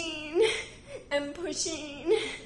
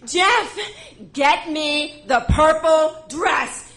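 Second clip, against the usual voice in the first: firm command; 5 semitones higher, 9 dB louder, slower than usual. Retold or imitated. Commanding husband.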